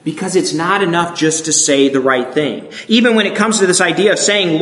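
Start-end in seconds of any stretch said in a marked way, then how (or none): none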